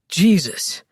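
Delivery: low, startled man's oath; deep-voiced jolt of alarm; startled exclamation. A narrator's reading. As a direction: blurt it out in surprise. Alex startled by the ghost.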